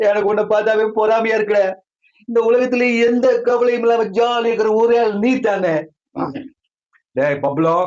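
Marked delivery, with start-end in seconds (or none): 0.1-5.7 high, plaintive whine; whiny envy. Acted. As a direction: moan enviously in a high put-on voice. Miserable friend envies carefree Jackie.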